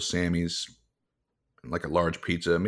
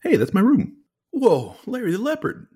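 surprised; 12 semitones higher, 4 dB louder than usual. Real, not acted. Surprised at seeing the game.

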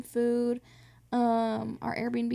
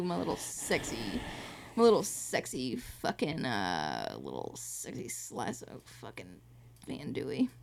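lower, growled silly endearment; 12 semitones lower, 8 dB quieter than usual. Pretend. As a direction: drop into a goofy seductive growl. Playful sign-off to partner.

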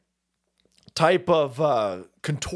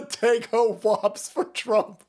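slow, higher-pitched, breaking into laughter; helpless giggling read; laughing; 4 semitones higher, much slower than usual. Real, not acted. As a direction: read it while cracking up. Cracks up reading another slogan.

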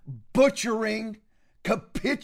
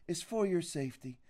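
much softer, mock-soothing aside; sarcastic mock; 3 semitones lower, 11 dB quieter than usual. Host mocks censors claiming it's for safety, soft and sarcastic. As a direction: purr it back at them with sarcasm.

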